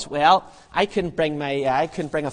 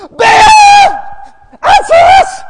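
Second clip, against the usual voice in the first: extremely high screamed plea; crazed louder shouting; 17 dB louder, much slower than usual. Acted. Prophets growing crazier for an answer, screaming. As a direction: scream it at the very top. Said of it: "they're getting louder".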